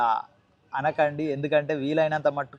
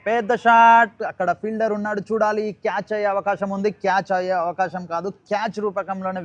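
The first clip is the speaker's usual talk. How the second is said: excited cricket commentary; 4 semitones higher, 4 dB louder than usual. Real, not acted. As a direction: build the suspense as the ball flies. Live call of a big shot.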